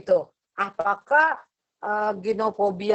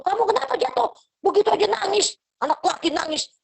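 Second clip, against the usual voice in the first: mock scolding parent, much higher; dismissive parent; 9 semitones higher than usual. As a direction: brush off the crying child impatiently. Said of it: "Itu kata-kata yang salah gitu loh".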